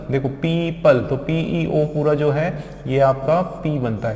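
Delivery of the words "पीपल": "पीपल" split as "pee-pal"; broken into syllables. Shown as a demonstration. Teacher pointing out the parts, "पीपल" syllable by syllable. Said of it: "यहाँ पर इसको break कर दो".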